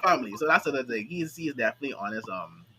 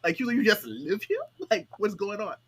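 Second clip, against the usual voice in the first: incredulous Takemichi; 6 semitones higher than usual. Retold or imitated. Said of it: "Takemichi is like".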